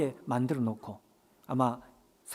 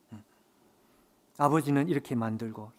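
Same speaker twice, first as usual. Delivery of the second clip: ordinary talk, nothing marked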